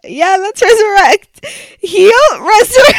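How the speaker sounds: a touch of laughter; amused. Real, not acted. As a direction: say resurrect grinning. Giggling at the word.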